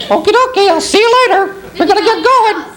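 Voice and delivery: very high cartoon puppet voice; puppet sign-off. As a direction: say a cheery goodbye in falsetto. Puppet impressions for guessing game.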